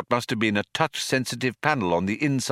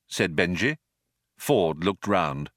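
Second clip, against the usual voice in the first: ordinary delivery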